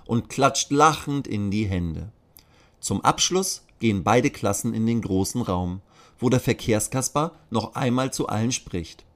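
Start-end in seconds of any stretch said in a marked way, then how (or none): none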